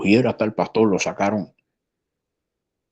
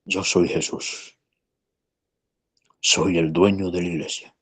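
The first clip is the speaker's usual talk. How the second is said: sweet voice. Retold or imitated. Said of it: "con voz dulce, le dijo".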